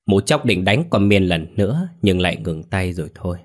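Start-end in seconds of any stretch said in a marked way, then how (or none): none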